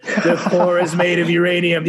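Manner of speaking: "The core is made of uranium" said loud and high, laughing through it; mocking repeat. Mocking repeat of the alien's claim.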